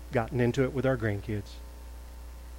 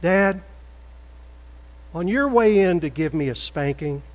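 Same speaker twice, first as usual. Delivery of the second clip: little voice; 5 semitones higher, 5 dB louder than usual. Retold or imitated. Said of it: "the little voice said".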